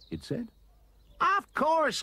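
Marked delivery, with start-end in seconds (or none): 1.2-2.0 very high, loud exclamation; crying out. A narrator's reading. Roy answers his anteater, crying out. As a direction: exclaim at the top of the range. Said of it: "cried Roy".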